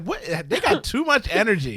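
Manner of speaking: high, disbelieving what; incredulous. Retold or imitated. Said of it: "and I'm like".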